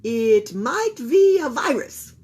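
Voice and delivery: confident doctor's verdict; announcing with authority. A narrator's reading. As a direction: pronounce it like an expert. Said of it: "he announced with authority".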